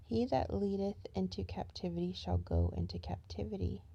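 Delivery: proclaiming the verse loudly; loud booming verse; loud scripture reading. Acted. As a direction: declaim it like a preacher. Reads scripture verse aloud.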